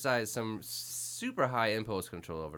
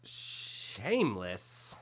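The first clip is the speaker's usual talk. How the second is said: drawn out; 2 semitones lower, much slower than usual. Real, not acted. Drawn-out repeat of show title.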